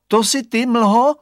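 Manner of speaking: put-on boy calling loudly into the fog; shouting into the fog. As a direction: call out high into the mist.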